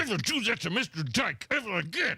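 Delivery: high-pitched mock scolding, jolting through the words; scolding teacher. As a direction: act out an irate teacher's telling-off. Acting out teacher scolding him.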